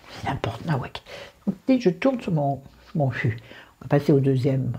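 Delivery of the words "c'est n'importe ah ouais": whispered aside. Real, not acted. Aside under her breath.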